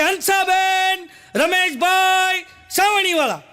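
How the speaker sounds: high, loud, drawn-out shout of names. Retold or imitated. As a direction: bellow the names at the door at night. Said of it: "એટલું બધું જોરથી બોલ્યું".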